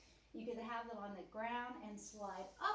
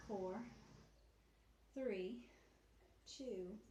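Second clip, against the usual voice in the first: counting while winded; breathless count after the plank; out of breath; 4 dB quieter, much slower than usual. Real, not acted. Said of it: "I'm still out of breath from the plank".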